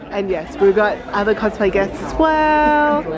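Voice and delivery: normal talking voice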